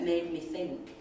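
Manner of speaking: ordinary talk, nothing marked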